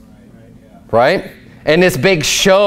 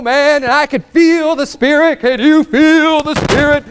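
mocking Pentecostal preacher; 10 semitones higher than usual. The preacher mimicking a showy Pentecostal leader at a service, hammed-up shout. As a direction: ham up a hand-waving preacher working the crowd. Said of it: "the guy up front waving his hands up".

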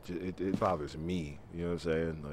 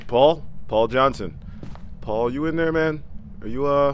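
loud, much higher calls; calling out; 8 semitones higher, 9 dB louder than usual. Real, not acted. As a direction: call into the dark house. Calling ghost loudly.

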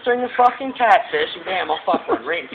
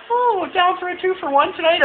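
much higher, teasing quoted woman; mock girly voice; 10 semitones higher than usual. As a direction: imitate her in a high, sing-song tone. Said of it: "She's like".

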